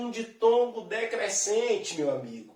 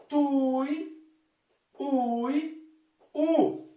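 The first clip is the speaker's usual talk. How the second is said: "Tui, ui, u" higher, each syllable set apart by a pause; separate, raised syllables in "Tui, ui, u"; 4 semitones higher, much slower than usual. Shown as a demonstration. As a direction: sound out "Tui, ui, u" slowly with gaps. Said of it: "olha só a separação".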